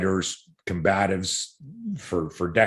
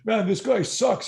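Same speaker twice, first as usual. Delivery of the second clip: high-pitched jeering fan voice, imitated; mock heckler; 13 semitones higher than usual. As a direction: sneer it like a know-nothing drunk. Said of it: "guys are going".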